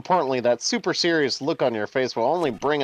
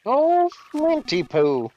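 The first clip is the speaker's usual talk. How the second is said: high, slow, sing-song fortune teller; Magypsy impression; 12 semitones higher, slower than usual. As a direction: coo the name like a fortune teller. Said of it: "That was my Magypsy impression".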